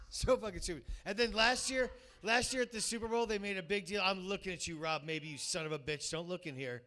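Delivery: ordinary delivery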